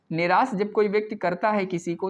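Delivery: normal talking voice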